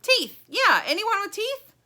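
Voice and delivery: high, loud eager shout; very high, excited character yell; bugle-like yell. A narrator's reading. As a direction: blare it out like a bugle. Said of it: "The bugle voice comes again".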